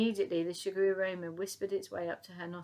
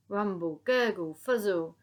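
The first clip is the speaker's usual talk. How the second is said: loud gurgling tummy sounds; tummy rumble noises; 6 dB louder than usual. Acted. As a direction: growl it like a rumbling belly.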